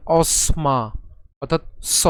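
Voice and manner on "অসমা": অসমা said with the dental s, ɔsma; অসমা pronounced ɔsma; correct pronunciation. Shown as a demonstration. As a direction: give অসমা as ɔ-sma, with a clear dental s. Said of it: "আমাদের উচ্চারণ কেমন হবে?".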